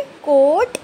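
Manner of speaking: teacher drawing out each letter loudly; slow loud spelling. Shown as a demonstration. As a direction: stretch every sound for young readers. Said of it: "मेरे साथ साथ पढ़िए".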